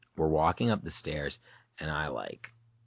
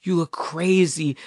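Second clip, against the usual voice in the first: high, louder mock scolding; imitated yelling mom; 11 semitones higher, 5 dB louder than usual. Mom in the car at three a.m., imitated yelling. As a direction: snap it like an exasperated mother. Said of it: "she's yelling at me".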